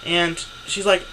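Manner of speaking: ordinary talk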